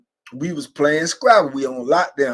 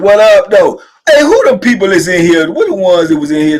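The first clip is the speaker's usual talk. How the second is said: loud, raised-pitch shout-out; calling out; 5 semitones higher, 9 dB louder than usual. Real, not acted. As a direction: holler a greeting to the viewers. Calling out to the live viewers.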